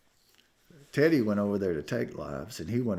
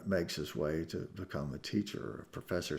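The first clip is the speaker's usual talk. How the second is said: ordinary talk, nothing marked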